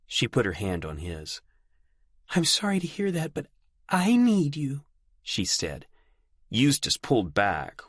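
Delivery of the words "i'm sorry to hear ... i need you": high, quick pleading; young woman voice. Acted. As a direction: plead with him. Delphi pleading.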